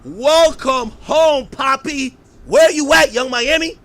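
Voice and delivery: slow, high, shouted slogan then challenge; loud callout. Pretend. Showing [name] the missing welcome sign, loud and demanding. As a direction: stretch the slogan out and holler it. Reading out sign, calling out absentee.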